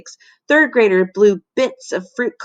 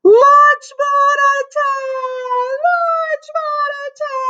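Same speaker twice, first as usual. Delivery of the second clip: much higher, slow, louder desperate cry; top of his voice; 4 dB louder, slower than usual. A narrator's reading. Melvin on the table screaming the warning, slow desperate cry. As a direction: stretch the alarm out at the top of the lungs. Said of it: "cried at the top of his".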